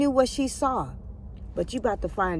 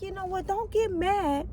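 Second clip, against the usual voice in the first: gentle warning; 8 semitones higher, 5 dB quieter than usual. Retold or imitated. Voicing herself to Balaam.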